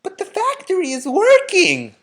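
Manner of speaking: high, loud crowd shout. A narrator's reading. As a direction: shout it out in amazement. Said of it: "the people shouted".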